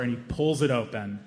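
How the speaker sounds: normal talking voice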